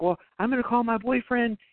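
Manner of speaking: high, fast, frightened threats to the caller; a hammed-up scared young woman bluffing; panicky girl voice. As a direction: squeak out the boyfriend threat in a rush. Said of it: "she's like".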